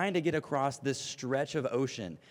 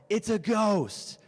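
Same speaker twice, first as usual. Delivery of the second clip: slow, stretched mock cry of terror, pitched up; terrified cry; 8 semitones higher, 4 dB louder, much slower than usual. A narrator's reading. Disciples crying out in fear at the figure on water, mock terrified cry. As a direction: cry out as if seeing a ghost. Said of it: "they cried out".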